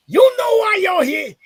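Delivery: high, loud barking drill instructor; drill instructor shout. Retold or imitated. Drill instructor yelling at a new recruit, high and loud. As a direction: yell it up high like a tiny furious instructor.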